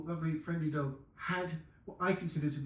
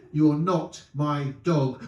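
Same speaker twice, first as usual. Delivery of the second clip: slow, louder, firm refusal; aloof woman; 7 dB louder, much slower than usual. Acted. Woman rebuffing the dog, slow and firm. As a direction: push the dog away slowly and firmly. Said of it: "At different levels".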